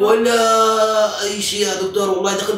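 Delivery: slow, high mock wail; high drawn-out wail. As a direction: stretch it out in mock despair. Exaggerated drawn complaint.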